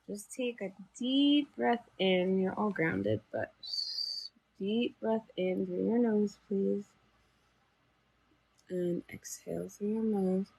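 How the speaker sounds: soft, calm breathing instructions; guided breathing. Acted. Leads group breathing meditation.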